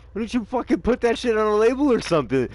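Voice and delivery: high, loud mock outrage; outraged rant. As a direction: rant at him loudly and high. Retold outraged rant at dealer.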